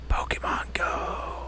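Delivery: slow, drawn-out whisper. Real, not acted. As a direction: whisper it slowly, letting the last word hang. Brings up hated topic after long pause.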